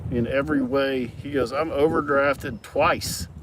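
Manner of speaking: slow mock complaint. Friend complaining about overdraft.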